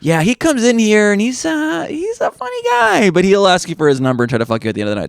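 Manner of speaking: high then fast gossiping voice; mock bartender gossip. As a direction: gossip about him to a coworker, high then rushing. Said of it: "is like".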